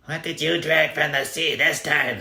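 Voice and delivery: shrill-pitched nasty bride, loud and high; horrible voice. Acted. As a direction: spit it out with contempt. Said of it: "said a horrible voice".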